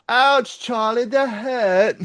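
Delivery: put-on high, slow British kid whine; drawn-out British complaint; slow British accent, childlike; British child accent. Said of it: "still doing the British accent".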